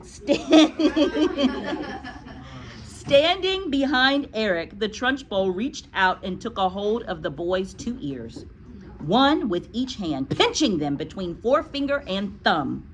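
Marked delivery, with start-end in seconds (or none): none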